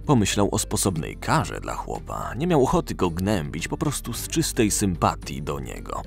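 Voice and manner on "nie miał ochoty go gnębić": ordinary delivery, nothing marked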